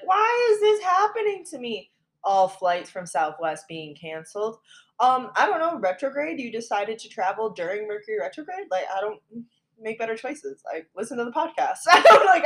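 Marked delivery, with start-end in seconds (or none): 0.1-1.9 high mock-whiny lament; whiny complaint. Voicing frustrated travelers. 11.9-12.5 laughing. Real, not acted. Laughs at own advice.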